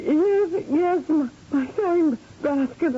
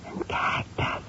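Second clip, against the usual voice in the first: hushed whisper; 7 dB quieter, slower than usual. Acted. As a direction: keep it to a whisper. Hiding from captor, whispering.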